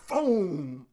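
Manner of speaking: ordinary talk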